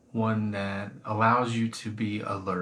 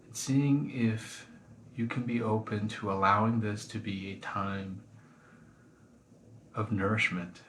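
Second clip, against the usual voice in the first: guided meditation. Acted. Teacher guiding listeners through a body scan, calm meditation voice. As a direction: ease them gently into the body scan.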